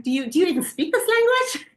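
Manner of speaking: lightly acted exasperation at the waiter; exasperated retort. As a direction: throw it back in disbelief. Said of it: "And I'm like".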